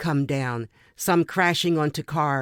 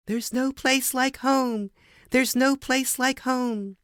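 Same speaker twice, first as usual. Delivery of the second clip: lightly voiced Dorothy, higher and slow, wishing for home; Dorothy quote; 9 semitones higher, slower than usual. Narrator quoting Dorothy as the tornado nears, a touch of wistful longing. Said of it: "quote Dorothy's famous line".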